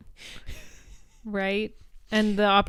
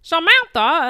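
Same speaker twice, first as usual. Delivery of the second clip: loud, higher mock disbelief; retold astonishment; 9 semitones higher, 12 dB louder than usual. Darren hears who they ran into, loud imitated shock. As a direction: blurt the name as a startled question. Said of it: "And Darren's like".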